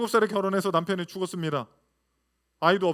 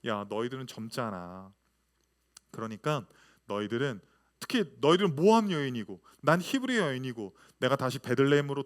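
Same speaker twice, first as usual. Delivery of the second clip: tender mother-in-law; 6 semitones lower than usual. Acted. Pastor voicing Naomi urging daughters-in-law.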